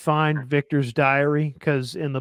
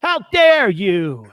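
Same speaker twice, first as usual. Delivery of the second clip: hammed-up scolding clergyman; indignant priest; slower than usual. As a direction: play an appalled priest, stretching the words. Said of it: "then the priest immediately looks at her and goes".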